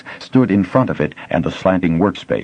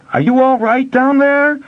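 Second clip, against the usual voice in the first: much higher, louder, calling from afar; plaintive call; 4 dB louder than usual. A narrator's reading. Master miner calling down from above, plaintive. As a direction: call down anxiously. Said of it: "Master Esselin's plaintive query".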